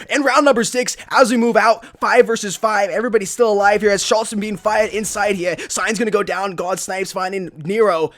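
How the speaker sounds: loud round-opening caster call; live play-by-play. Real, not acted. Caster starting round six for viewers, energetic play-by-play. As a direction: launch the new round with energy.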